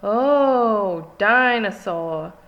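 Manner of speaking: put-on slow, loud little-boy voice; toddler George voice. A narrator's reading. Little George admiring the dinosaur, slow and loud. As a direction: drag it out in awe, like a toddler. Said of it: "says George".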